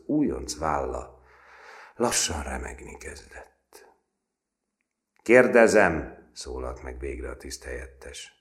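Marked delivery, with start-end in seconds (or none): 5.2-6.3 loud, slow, weighty opener; stern sergeant voice; stern loud question. A narrator's reading. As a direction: make the silence break hard. Sergeant finally speaks.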